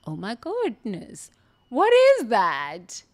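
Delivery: awed at the dome; amazed. Retold or imitated. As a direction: draw it out in wonder. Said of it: "And I said".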